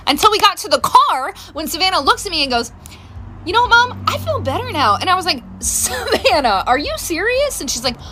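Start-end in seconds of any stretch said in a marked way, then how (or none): 3.5-5.0 put-on higher, chirpy teen voice; suddenly bright and cured; perky daughter. Daughter in the car, suddenly fine, chirpy. 5.6-7.6 slow drawn name, then high; disbelief. Retold or imitated. Mom catching her daughter faking, disbelieving.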